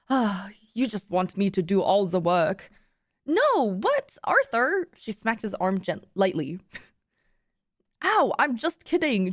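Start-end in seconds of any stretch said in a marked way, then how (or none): 0.1-2.7 mild sighed complaint in a put-on accent; sighing. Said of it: "Arthur sighed". 3.3-4.9 high-pitched, questioning indignation; shocked protest. Acted. Fiona protests Arthur's accusation. 8.0-9.3 high yelp easing into a protest; yelp, then plead innocence; mock hurt. Arthur reacts to being smacked.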